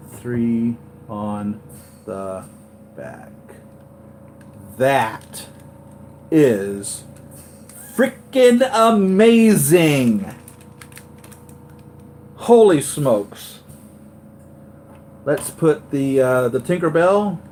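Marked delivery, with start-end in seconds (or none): 4.8-13.6 loud, drawn-out amazement; amazed outburst. Real, not acted. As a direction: stretch the words out in real awe. Awed by the lit-up figure.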